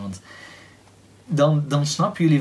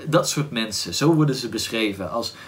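ordinary delivery

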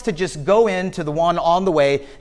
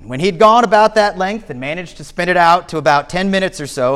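normal talking voice

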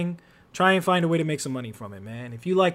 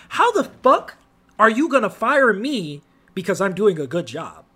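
ordinary talk, nothing marked

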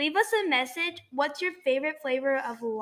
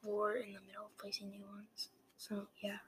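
whispering; 4 semitones lower, 19 dB quieter than usual. Real, not acted. Whispering aside to viewers.

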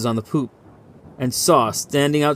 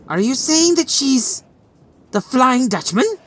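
much higher, fearful voice; frightened; 12 semitones higher than usual. A narrator's reading. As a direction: dread the answer as you ask. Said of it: "His sudden fright had been so evident".

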